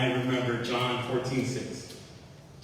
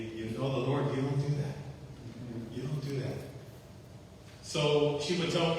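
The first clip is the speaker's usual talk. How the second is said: usual speaking voice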